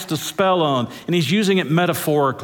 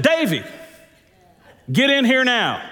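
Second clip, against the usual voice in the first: mother's sharp summons, pitched up; stern mother call; 8 semitones higher than usual. Retold or imitated. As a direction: call the boy in, meaning trouble. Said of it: "there was another tone".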